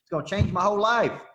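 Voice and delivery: imitating an attendee gushing to the coach; gushing excitement. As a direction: ham up the eager attendee's promise. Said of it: "here's what they'd say".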